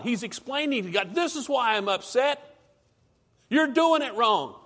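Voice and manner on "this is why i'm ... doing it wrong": higher, louder whining at God; whiny complaint. Retold or imitated. Preacher voicing Jonah complaining to God, whiny. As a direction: complain like a sulky child. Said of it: "He's just a whiner".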